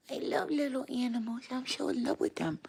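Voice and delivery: high, airy doting voice. Real, not acted. Gushing over animals in baby voice.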